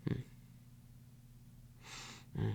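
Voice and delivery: normal talking voice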